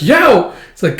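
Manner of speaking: high, loud mock yelp; pained yelp. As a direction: yelp over a throbbing hand. Character hurts hand punching.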